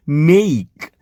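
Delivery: મેઈક with a long ei glide. Shown as a demonstration. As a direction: stretch મેઈક as mei-k. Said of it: "એ તરીકે ઉચ્ચાર થશે".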